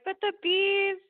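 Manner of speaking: put-on high, loud childlike lament; imitating her inner small child; mock little-girl whimper; crying five-year-old. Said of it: "five-year-old self ... crying".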